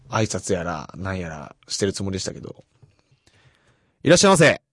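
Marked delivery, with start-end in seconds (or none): 4.0-4.6 loud, bright welcome at the shop counter; 元気な接客の声で「いらっしゃいませ」; shop greeting. Shown as a demonstration. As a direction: call out a welcome to customers, a bit higher. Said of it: "あ、こんな感じですね".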